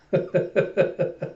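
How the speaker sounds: high, breath-only giggle; gloating snicker. Acted. Hidden watcher enjoys a rival's failed date, snickering. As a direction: snicker to yourself. Frankie gloating at Lloyd's failure.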